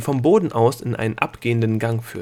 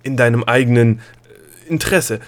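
out of breath, louder, gasping words; panting; 6 dB louder than usual. Acted. Gnome catches up with the princess, panting out of breath. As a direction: gasp it out after running. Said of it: "japste Opal".

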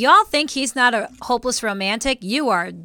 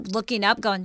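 usual speaking voice

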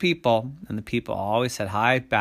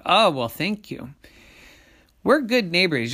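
higher, louder pleased thanks; grateful people; 7 semitones higher, 5 dB louder than usual. A narrator's reading. People thanking monsters for letting them stay, grateful. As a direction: thank them brightly with raised pitch.

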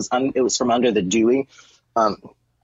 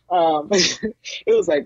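usual speaking voice

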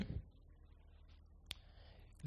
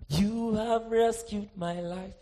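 prayerful; much slower than usual. Acted. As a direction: breathe it out in thanks. Prayer voiced softly.

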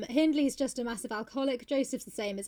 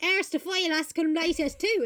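high, loud garbled rustic dialect; mock incomprehensible country speech; Joseph; 6 semitones higher, 4 dB louder than usual. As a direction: spout thick Old English dialect. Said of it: "it'll be like".

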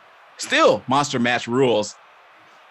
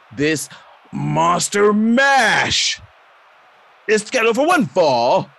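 ring announcer; 5 semitones higher than usual. Acted. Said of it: "And you hear".

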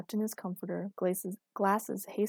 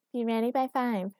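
higher-pitched mimicking echo; mocking repetition; 4 semitones higher, 4 dB louder than usual. A narrator's reading. Girlfriend repeats his order under the covers, mocking. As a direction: parrot the order back at him. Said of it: "sotto voice".

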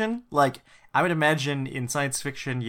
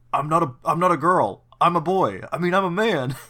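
louder put-on tough-guy voice; a kid straining to sound tough; Spider-Man interrogation voice; 4 semitones higher, 5 dB louder than usual. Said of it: "The voice sounds like".

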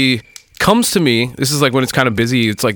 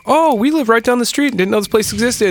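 high-pitched mock customer voice; chirpy newcomer impression; 10 semitones higher than usual. First-time customers praising the bar, mock chirpy. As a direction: imitate a pleasantly surprised newcomer, pitched way up.

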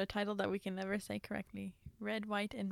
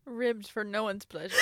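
yelled through laughter; laughing shout. Real, not acted. Joking to co-host about pickles, laughing. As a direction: crack up while joking.

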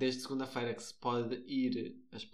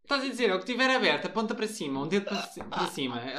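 higher, louder, projected; speaking up; 5 semitones higher, 7 dB louder than usual. Shown as a demonstration. Told to speak up, keeps projecting loudly. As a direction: keep it loud for the listeners. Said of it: "tenho que falar mais alto".

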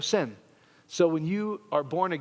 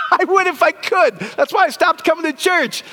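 whiny churchgoer; 13 semitones higher, 7 dB louder than usual. Pretend. Pastor voicing a reluctant churchgoer, high whiny plea. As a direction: whine like a guilty churchgoer.

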